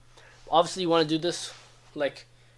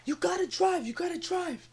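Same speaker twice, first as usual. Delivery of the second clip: coach yelling; 4 dB quieter than usual. Retold or imitated. Coach yelling at younger kids to drive, high-pitched mock yell. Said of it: "I yell at them".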